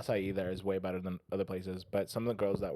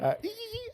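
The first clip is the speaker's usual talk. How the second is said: very high squeaked "ee" sounds; trying to hit a squeak; squeaky note attempt. Shown as a demonstration. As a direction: squeal out tiny high notes. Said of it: "you missed the note on that one".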